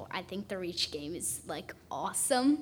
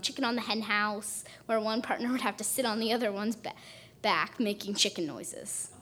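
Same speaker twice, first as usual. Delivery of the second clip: ordinary talk, nothing marked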